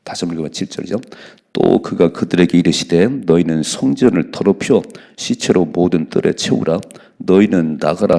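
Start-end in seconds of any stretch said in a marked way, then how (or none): 1.5-8.2 loud, slow, weighty reading of the verse; scripture reading. Acted. Preacher reading the verse loudly to his congregation. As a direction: proclaim the verse slowly to the congregation.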